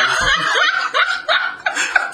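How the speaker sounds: high-pitched burst of laughter; big laugh. Real, not acted. Recalling the Sabertoothless taunt, laughing high and hard. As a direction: crack up at the insult.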